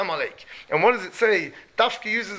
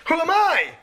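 high, disbelieving who am I; incredulous; 8 semitones higher, 4 dB louder than usual. Retold or imitated. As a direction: scoff at your own worth. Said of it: "What, I can bring".

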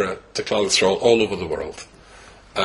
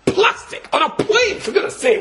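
much higher, squealing mock outrage; outraged disbelief. To the audience about plastic plane seats, high incredulous squeal. Exasperated complaint about budget airline.

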